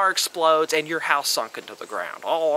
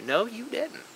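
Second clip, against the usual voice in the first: softer, rising, half-questioning rebuttal; skeptical no; 7 semitones higher, 5 dB quieter than usual. Shown as a demonstration. Answering the excuse "I had to", a skeptical rising no. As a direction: ask "No, you didn't" with a doubtful lift. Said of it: "repeated rebuttal, second firmer".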